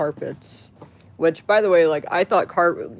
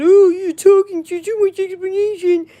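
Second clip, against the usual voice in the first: high, loud, whiny sneering imitation of a commenter; mock the hater in a shrill-sounding put-on voice; sneering impression of a commenter, much higher and louder; 9 dB louder than usual. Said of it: "some fucking piece of shit said".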